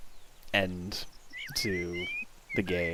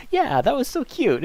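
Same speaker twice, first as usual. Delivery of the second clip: delighted squeal; 13 semitones higher, 7 dB louder than usual. Real, not acted. Delight after the game.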